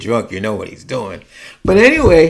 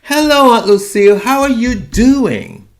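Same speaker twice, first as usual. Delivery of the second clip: cheerful phone greeting; 8 semitones higher, 3 dB louder than usual. Retold or imitated. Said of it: "and I would go".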